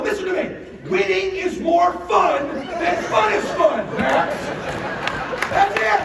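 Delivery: high, loud and slow, every word hammered; slow fired-up shout. Retold or imitated. Dad lecturing son that winning is fun, slow shout. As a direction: preach winning to him like a crazed coach.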